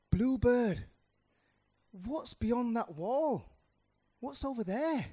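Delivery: high, slow wondering questions; curious little mouse. Acted. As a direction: call out high, full of curiosity. Mouse eagerly questions the bluebird.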